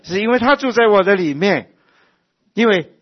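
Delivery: high, loud sermon cry; high, loud preaching; full-force preaching. Acted. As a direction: preach it out with fervour.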